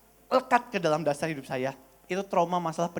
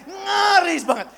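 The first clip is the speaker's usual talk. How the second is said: high, loud, stretched-out exclamation; near scream; 10 semitones higher, 7 dB louder, slower than usual. Acted. Preacher recalling yearly dread, nearly screaming. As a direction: cry it out slowly, high and loud. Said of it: "saya mau teriak".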